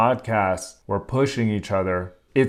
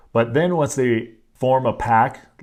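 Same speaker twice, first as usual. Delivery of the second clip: ordinary talk, nothing marked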